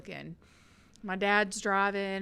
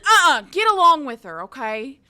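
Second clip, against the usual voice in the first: stern dad; 5 semitones higher, 14 dB louder than usual. Retold or imitated. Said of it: "and he said".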